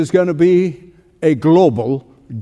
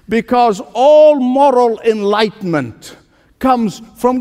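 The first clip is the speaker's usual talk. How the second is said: higher, slow, ringing pulpit build; preaching at full force; 5 semitones higher than usual. Acted. A preacher rising to his point before the congregation, full force. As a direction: lift the church with each phrase, slowly.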